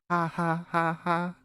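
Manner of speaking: stiff, spelled-out robot laugh; robotic laugh. Acted. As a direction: laugh like a voice assistant. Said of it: "you sound like that weird Alexa laugh thing".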